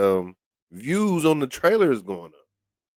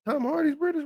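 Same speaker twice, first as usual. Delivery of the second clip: surprised question; 13 semitones higher, 5 dB quieter, faster than usual. Real, not acted. Realising the actor is British.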